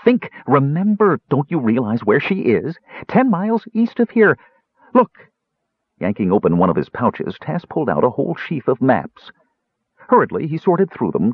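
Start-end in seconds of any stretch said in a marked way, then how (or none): 0.0-5.3 high-pitched, insistent pleading; urgent. A narrator's reading. Tass urging Caramon to realize where she is, urgent. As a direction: press each point, getting worked up. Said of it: "said Tass urgently".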